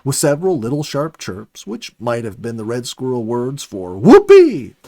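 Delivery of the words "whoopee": high, loud gleeful shout; whoopee cry. A narrator's reading. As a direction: let it burst out with glee. Squirrel's imagined joyful cry.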